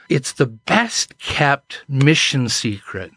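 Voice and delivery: ordinary talk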